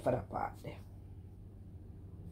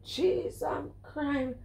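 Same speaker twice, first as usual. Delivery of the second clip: drawn-out high exasperation; 11 semitones higher, much slower than usual. Real, not acted.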